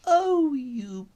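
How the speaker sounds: high, slow, sympathetic aunt's cry; high, slow fussing concern. Acted. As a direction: coo over him with high pity. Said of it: "cried Aunt Loopy".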